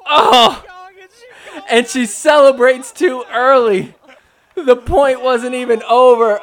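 loud, high outcry of disbelief; excited play-by-play. Real, not acted. Commentator reacting to a celebration come too early, loud and excited. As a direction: call the blown point at full voice. Calling a dramatic botched rally.

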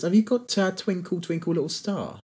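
put-on high, wandering mystery accent, sliding toward French; a Cockney gangster slipping into French; garbled put-on accent. A friend hamming a karaoke request in a garbled accent. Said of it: "I don't know what accent that was".

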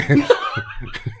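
high, voiceless chuckle; short high burst of laughter; laughing. Real, not acted. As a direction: laugh at your own dig. Laughs at own dig.

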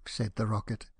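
plain reading voice

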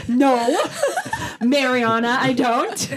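giggling through the words; laughing while imitating. Mimicking friend through laughter.

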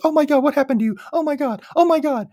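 high-pitched mock panic; freaking out. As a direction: imitate a mother's rapid, frantic exclamations. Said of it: "it's mainly Patty freaking out".

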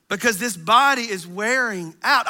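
normal talking voice

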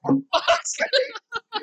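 high, drawn-out laughing groan; real laughing exasperation. Real, not acted. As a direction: curse through helpless laughter. Cracking up over a missed pun.

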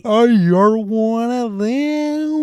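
high, very slow, drawn-out scared voice; mock scared American accent. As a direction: overdo a frightened villain faking an accent. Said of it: "trying to sound scared at the same time".

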